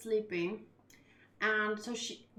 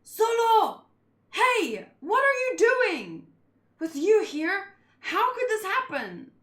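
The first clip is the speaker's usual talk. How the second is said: high, loud, panicked calling out; frantic high character voice; 9 semitones higher, 7 dB louder, slower than usual. Acted. A frantic character voice calling out high after finding a fallen crewmate. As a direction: cry out in alarm over a fallen friend.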